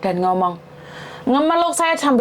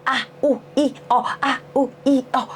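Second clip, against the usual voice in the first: mild, halting mock moans; lightly imitated bedroom noises. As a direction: recite overheard moans as separate little syllables. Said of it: "saya cuma dengar".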